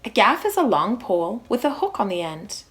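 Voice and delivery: ordinary delivery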